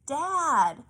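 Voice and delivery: put-on high child's yell through the door. A narrator's reading. Luca yelling at the workshop door. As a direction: call out loud and high to Dad. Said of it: "yelled Luca".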